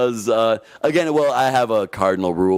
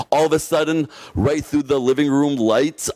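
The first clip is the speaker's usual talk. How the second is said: usual speaking voice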